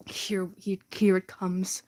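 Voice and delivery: hushed unvoiced whisper. Real, not acted. Whispering to the viewers as the speech begins. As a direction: whisper it so nobody hears.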